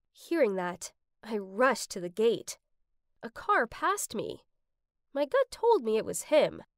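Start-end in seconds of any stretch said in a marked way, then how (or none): none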